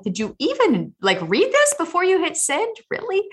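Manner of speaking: high, incredulous rising voice; exasperated, can you believe this; exasperated disbelief. Retold or imitated. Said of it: "it's like".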